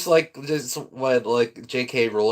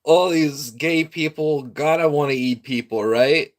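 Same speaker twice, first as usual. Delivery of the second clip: loud, raised-pitch mock agreement; sarcastic; 5 semitones higher, 4 dB louder than usual. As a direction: exaggerate agreement, plainly not meaning it. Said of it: "I'm being sarcastic".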